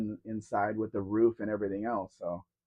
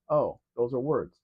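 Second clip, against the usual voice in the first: normal talking voice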